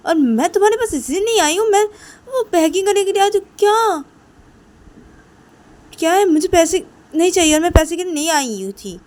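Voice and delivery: high, loud angry tirade; heated, offended outburst; furious refusal. Acted. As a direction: shout the refusal, pitched right up. Ruhi angrily refuses the money.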